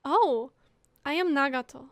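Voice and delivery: normal talking voice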